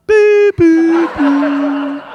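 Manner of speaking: high, loud electronic beeps; three piercing held tones, stepping down; imitated beeps. Announcer imitation closing with platform chime, loud high beeps.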